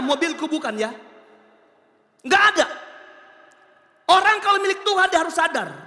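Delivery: high, loud pulpit exhortation; fired-up sermon, pitch held high; full-force preaching. Real, not acted. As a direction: drive it home to the congregation.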